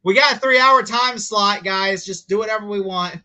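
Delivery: loud, high, slow announcement; put-on carefree boss voice; mock broadcaster. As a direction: address the crew with hammed-up breeziness. Mocking broadcasters filling time.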